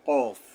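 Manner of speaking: কফ loud and heavy from the tongue root; thick, heavy articulation. Shown as a demonstration. Teacher models the two-dot letter, loud thick কফ. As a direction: hit কফ hard and thick, far back at the tongue root. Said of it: "মোটাভাবে আদায় হবে".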